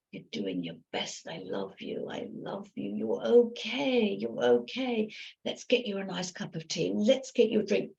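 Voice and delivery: soft, gentle reassurance; tender self-talk. Shown as a demonstration. Coach models talking to yourself as to a loved one, softly. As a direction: soothe yourself like a child. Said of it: "Talk to yourself the way you would to a precious loved one".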